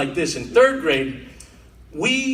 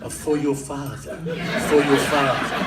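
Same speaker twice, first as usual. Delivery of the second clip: low, hushed, knowing mob voice; mock baker whisper; 5 semitones lower than usual. Baker handing the boy an envelope, low and knowing.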